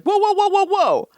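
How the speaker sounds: high, rapid string of whoas; guards halting someone. Retold or imitated. New security guard stops Joy at the door, halting whoas. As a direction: imitate guards stopping someone in their tracks.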